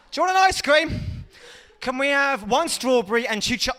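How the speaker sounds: very high, bright mock-cheerful uncle voice; fake fun uncle. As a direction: lure the kids with fake fun. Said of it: "go round there and go".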